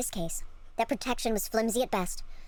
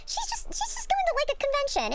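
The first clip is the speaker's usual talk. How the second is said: very high, laughing coo; gushing coo. Real, not acted. Streamer fondly excusing the costumed girl, high laughing coo. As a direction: fuss over her in a squeaky high voice.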